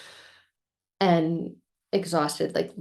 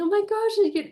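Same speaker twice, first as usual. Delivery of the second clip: much higher, a bit louder, gushing; mock teary joy; 4 dB louder, faster than usual. Girlfriend answering the fake proposal, high gushing squeal. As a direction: squeal it like an overwhelmed bride. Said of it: "and me just crying".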